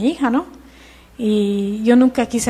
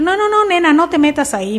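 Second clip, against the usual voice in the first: high insistent mock-scolding, a bit louder; scolding warning; 7 semitones higher, 3 dB louder than usual. Mother scolding her daughter away from the Bible, insistent and high. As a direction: forbid it like a worried mother.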